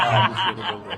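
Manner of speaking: loud self-mocking laugh; real rueful laugh; laughing at himself. Real, not acted. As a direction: laugh at your own foolishness. Laughs at own foolishness.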